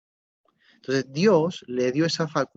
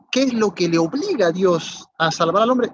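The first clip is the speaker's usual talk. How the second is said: rising preaching question; 6 semitones higher, 6 dB louder than usual. Real, not acted. Preacher presses the key question.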